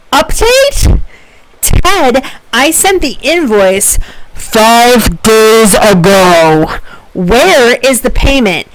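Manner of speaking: exasperated, high-pitched demand in slow, punched words; angry, aggravated, frustrated. Acted. Professor acting out Amy's email to a coworker, angry and frustrated. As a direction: act out a fuming email, dragging out key words. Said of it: "I blew out your eardrums".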